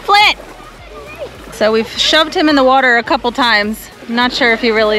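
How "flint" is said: high, loud call across the beach; calling the dog. Real, not acted. Calling Flint the dog back from the water, loud high call. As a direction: shout his name to call him in.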